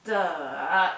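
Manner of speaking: the syllable "the" dipping low then rising; third tone demo. Shown as a demonstration. Teacher demonstrating the dipping third tone. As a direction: drop "the" down and bring it back up. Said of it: "it's a U tone".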